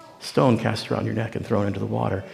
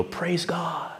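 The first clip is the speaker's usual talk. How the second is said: relieved praise; 5 semitones higher, 8 dB quieter than usual. Retold or imitated. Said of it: "You go".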